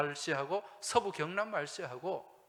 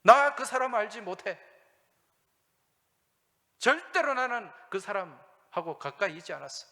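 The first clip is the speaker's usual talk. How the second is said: shouted, much louder, furious oath; Peter's cursing denial; 3 semitones higher, 10 dB louder than usual. Acted. Preacher voicing Peter cursing at the accusers, loud furious oath. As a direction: hurl the denial like a curse.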